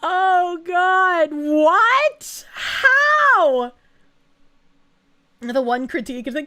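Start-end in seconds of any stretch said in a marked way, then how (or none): 0.0-3.7 loud, long wail of disbelief; appalled, incredulous yelling; stunned outcry. Real, not acted. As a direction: draw each word out high and loud. Reacting to shocking news about someone.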